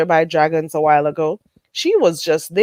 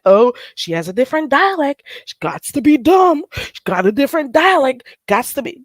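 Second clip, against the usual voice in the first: higher-pitched, sing-song mockery; mocking critics; 6 semitones higher than usual. Streamer mimicking people who judge her dialect, mocking. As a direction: imitate a scornful critic in a higher voice. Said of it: "And act like".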